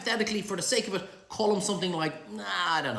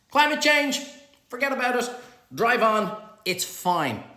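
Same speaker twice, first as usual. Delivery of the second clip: higher and louder, breezy dismissal; loud carefree brush-off; 7 semitones higher, 7 dB louder than usual. Acted. President scripting Gil's cheerful public recantation, loud and carefree. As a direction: shout it like nothing ever mattered. Gil ordered to recant loudly.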